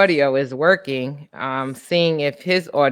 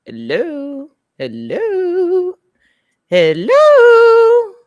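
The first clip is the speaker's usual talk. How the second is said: hello climbing higher and louder each time; rising sing-song hello; 13 semitones higher, 6 dB louder, much slower than usual. Real, not acted. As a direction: call out, going up till it's almost shouted. Mic test, nobody answers.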